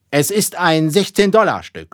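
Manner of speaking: showman-like captain's call; proclaiming. A narrator's reading. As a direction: announce the prize to the crew. Captain showing crew the coin.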